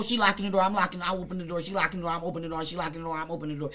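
low, soft, rattled-off repetition; rapid back-and-forth. Retold or imitated. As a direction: run the phrases together, speeding up and fading. Retelling a lock and unlock back-and-forth.

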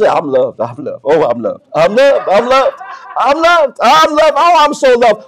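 a loved dog, murmuring then rising in delight; contented dog's voice. Acted. Preacher voicing a petted dog on a lap, contentment building to delight. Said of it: "and that dog says".

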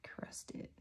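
breathy whisper to herself. Real, not acted. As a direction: whisper it under your breath. Whispered aside while painting.

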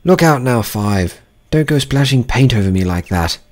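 a lightly voiced gardener, sharp. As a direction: warn a fellow gardener. Gardener calling a warning.

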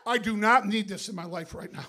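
normal talking voice

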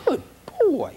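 put-on high, bright "good boy"; praising the dog. Shown as a demonstration. Trainer praising a brave dog, high happy praise. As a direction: cheer the dog on.

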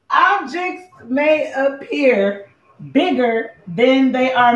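slow, drawn-out recital; measured, stretched-out warning notice; deliberate recital. Real, not acted. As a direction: spell it out word by word, slowly. Recites mirror warning again, deliberately.

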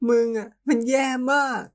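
high, cracking tearful cry; high tearful reproach at the one who left; tearful outburst. Acted. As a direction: let the hurt spill out as you blame him. Hurt lover breaking down.

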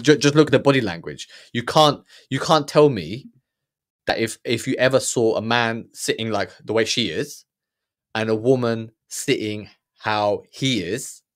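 usual speaking voice

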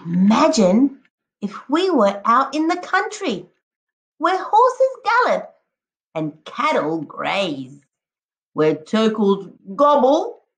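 lively, sing-song picture-book read-aloud; expressive storybook voice with a howl at the end; reading to children. Acted. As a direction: paint each jungle animal for the class. Teacher reads picture book aloud.

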